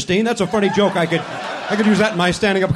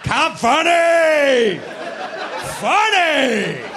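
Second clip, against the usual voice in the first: slow, high drawn-out mock yell; hammed-up announcer bellow; mock shock-comic shout; 7 semitones higher, 4 dB louder, much slower than usual. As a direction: sneer at cheap shock humor. Mocking shock comedy.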